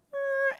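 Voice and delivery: high-pitched comic creaking noise; imitated creak. Storyteller voicing walls that start to lean, high creak. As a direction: creak like a wall tipping.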